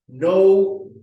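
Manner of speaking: ordinary talk, nothing marked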